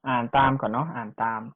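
ordinary talk

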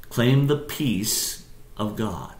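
"Claim the peace of God" stretched out at half speed; deliberate, drawn-out "Claim the peace of God"; slow emphasis. Shown as a demonstration. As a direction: say "Claim the peace of God" slowly, letting each part sink in. Point repeated slowly for emphasis.

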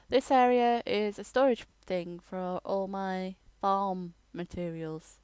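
usual speaking voice

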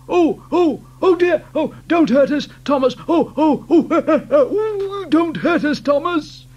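high, loud pleading whine; frightened trucks wailing. Acted. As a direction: beg for mercy in panic. Trucks begging Thomas.

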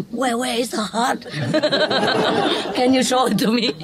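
asking while chuckling; a clueless surgeon, half-laughing; a doctor laughingly asking the nurse where the heart is; laughing mock doctor.

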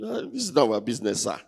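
ordinary delivery

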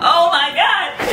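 high, loud fan squeal; squealing in disbelief; ecstatic shriek. Real, not acted. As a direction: scream it with delight. Overwhelmed by the teaser.

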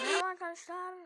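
big, loud hello to viewers; loud greeting. Real, not acted. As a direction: shout the greeting out. Loud greeting opening video.

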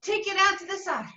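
upbeat workout cues over music; aerobics instructor. Real, not acted. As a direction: call the moves to the class. Calling aerobics moves over music.

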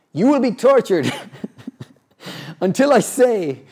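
mock villain dad. Dad teasing his son about practice, mock villain. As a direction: play the cartoon evil father, laughing. Dad answers son's complaint.

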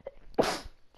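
sudden harsh sneeze; sneezing. Real, not acted. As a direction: let out a real sneeze. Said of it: "sorry for the sneezing".

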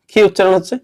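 usual speaking voice

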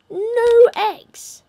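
a hammed-up cry of outrage; mock dismay shout. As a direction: shout it high and loud in mock horror. Dismay at empty coop.

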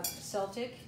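"Celtic" starting with an S sound; soft C. Shown as a demonstration. Giving her own way of saying it, "Celtic" with soft C. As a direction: say "Celtic" as SELL-tick. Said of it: "I say".

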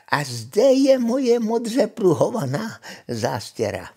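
high, wavering witch voice; witch, obliging. Acted. Witch hands over apron. (čarodějnice vysokým, rozkolísaným hlasem)

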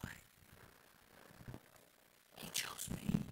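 breathless hushed wonder; awed whisper. Acted. Believer realizing in awe, whispered.

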